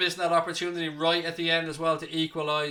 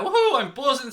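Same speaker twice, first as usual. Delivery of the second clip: mock excitement; 6 semitones higher, 6 dB louder than usual. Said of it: "says Free the Chord Music".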